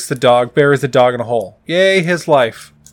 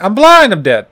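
put-on much higher and louder child voice; mock little girl; 6 semitones higher, 6 dB louder than usual. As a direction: squeal it like a little kid. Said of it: "And then she's like".

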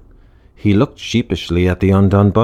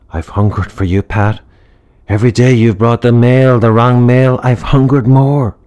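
louder, rising passion; passionate; 5 semitones higher, 6 dB louder than usual. Acted. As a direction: build from sultry to fervent. Seductive vampire confession.

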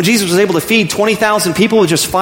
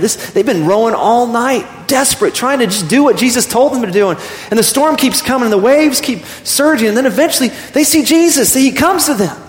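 higher, rising preacher's cadence telling the storm; impassioned preaching; 6 semitones higher than usual. Acted. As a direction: paint the desperate storm for the congregation. Preacher builds the storm scene.

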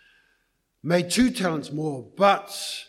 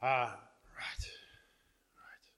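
whispered mutter; 6 semitones lower, 12 dB quieter than usual. Acted. Joke story, man muttering to himself.

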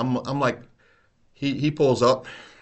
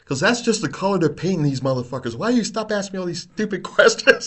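louder and higher, fed up and laughing at the end; exasperated old cop; 11 semitones higher, 6 dB louder than usual. Retold or imitated. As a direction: blurt it out like a fed-up veteran. Partner snaps at rookie.

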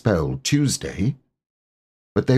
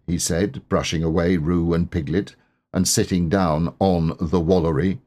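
usual speaking voice

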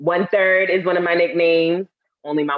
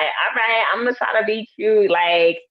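put-on higher, girlish eager pleading; imitating her younger self, high-pitched; trying to be cute; 5 semitones higher than usual. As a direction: play cute to impress a crush. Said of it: "was like".

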